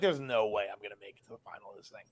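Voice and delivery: low, fast, hushed self-doubt; doubtful inner voice. Retold or imitated. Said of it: "I'm like".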